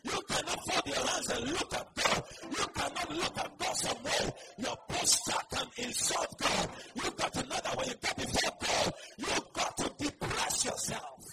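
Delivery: loud, high, driving sermon; full-force preaching. Real, not acted. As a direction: hammer each statement at the congregation.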